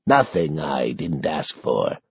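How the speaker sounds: resigned sorrow; sad. A narrator's reading. Old man admitting his choice, low sad voice. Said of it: "Sanderson replies sadly".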